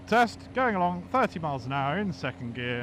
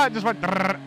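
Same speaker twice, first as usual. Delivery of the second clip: laughing; 2 semitones higher than usual. Real, not acted. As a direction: laugh through the engine noise. Exhaust noise imitated.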